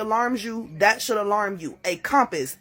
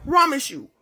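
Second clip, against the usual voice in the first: emotional vow; 8 semitones higher, 6 dB louder, slower than usual. Real, not acted. Swearing it's true, emotional.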